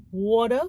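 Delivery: "water" with a D in the middle, like "wadder"; American pronunciation. Retold or imitated. American correcting the newcomer's word, "water" said as "wadder". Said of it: "they'll be like".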